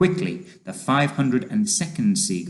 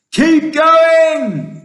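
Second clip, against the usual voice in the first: high, loud cheering cry; rousing drawn-out shout; 11 dB louder than usual. A narrator's reading. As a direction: urge on at full voice. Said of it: "they cried".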